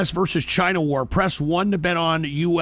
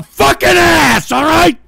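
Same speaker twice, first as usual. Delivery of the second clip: louder, higher furious outburst; angry yell; 9 semitones higher, 9 dB louder, slower than usual. Real, not acted. Host raging at people laughing along, shouting. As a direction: let the anger boil over.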